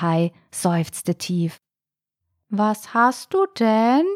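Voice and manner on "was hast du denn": slow, high snail voice; thin little voice. A narrator's reading. Snail asks the little shark what's wrong, thin-voiced. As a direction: ask slowly in a thin, drawn-out voice. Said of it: "fragte da eine dünne Stimme".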